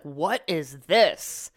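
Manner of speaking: slow, drawn-out imitated husband; lightly voiced husband; mock husband question. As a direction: drag out his puzzled question. Husband questioning the pizza dough.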